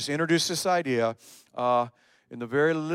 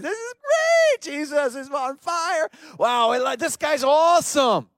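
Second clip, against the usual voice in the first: very high, loud, hammed-up excitement; gushing fan; 11 semitones higher, 5 dB louder, slower than usual. Crowd posting about Jesus, hammed-up gushing excitement. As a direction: squeal it like a starstruck fan. Acts out amazed fans of Jesus.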